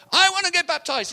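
imitating an eager shout, very high and loud; excited girl. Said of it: "really excited in the water".

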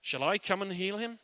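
Jesus putting up a barrier with a question; testing question. Retold or imitated. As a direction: ask it as a gentle challenge. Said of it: "put a bit of a barrier there and saying".

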